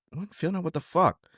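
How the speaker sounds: incredulous disbelief. Retold or imitated. Coach to wrestler who wasn't feeling it, incredulous and high. Coach hears kid's excuse.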